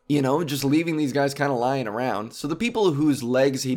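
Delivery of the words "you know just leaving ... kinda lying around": ordinary talk, nothing marked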